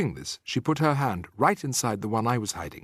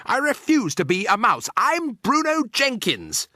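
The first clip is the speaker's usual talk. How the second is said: high, loud squeal of refusal; outraged bawl, very high at the end; tantrum shout; 12 semitones higher, 5 dB louder, slower than usual. A narrator's reading. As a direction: shout it defiantly, drawing out the name. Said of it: "Bruno shouted, jumping up and down".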